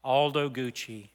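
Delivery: ordinary talk, nothing marked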